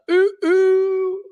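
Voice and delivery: hyped falsetto ooh hooting high. Pretend. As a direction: whoop it up like a party. Hooting while raising the roof.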